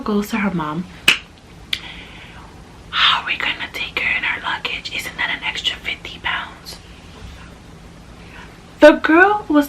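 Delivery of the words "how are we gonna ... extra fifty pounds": hushed, breathless whisper. Retold or imitated. Girl secretly asking her mom about the luggage, whispered. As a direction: whisper it behind your hand.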